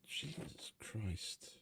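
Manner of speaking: very slow, hushed whisper of disbelief; drawn-out whispered groan of exasperation; exasperated whisper. Real, not acted. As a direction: whisper it slowly, exasperated at what you just heard. Reacting to bad game voice acting.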